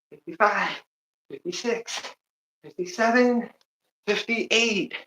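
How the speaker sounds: strained, breathless rep counting; counting through squats. Real, not acted. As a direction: count reps while squatting hard. Counting reps during squats.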